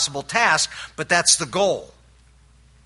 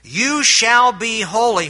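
"shall" hit much higher and louder, slow and falling; emphatic command; 7 semitones higher, 6 dB louder, slower than usual. Shown as a demonstration. Preacher quoting Peter's emphatic command to the congregation, slow and forceful. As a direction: declare it slowly with full force on "shall". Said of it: "this is an emphatic command".

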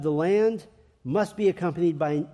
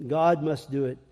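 ordinary talk

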